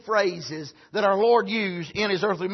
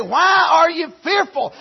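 higher, rebuking question; mock rebuke; 6 semitones higher, 4 dB louder than usual. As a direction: chide him for being afraid. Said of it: "He didn't say".

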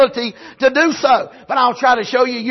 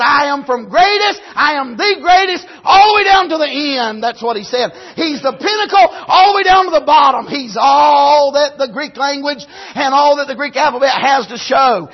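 higher, louder preacher's climax; shouted preaching; 3 semitones higher, 3 dB louder than usual. Acted. Preacher shouting Jesus is the greatest, full force. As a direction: lift it to the pinnacle.

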